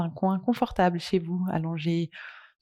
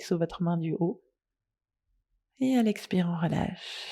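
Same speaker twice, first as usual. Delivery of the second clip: soft, unhurried yoga nidra guidance with long pauses; guided meditation; 5 dB quieter than usual. Acted. Leads a guided relaxation.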